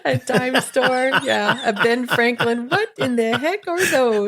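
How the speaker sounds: high voice, laughing through words. Real, not acted. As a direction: talk through giggles. Laughing at dime store joke.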